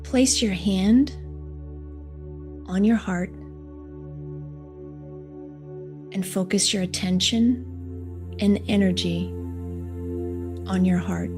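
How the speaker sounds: soft, slow meditation voice; guided meditation. Acted. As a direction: guide them gently into calm, unhurried. Leads a heart meditation.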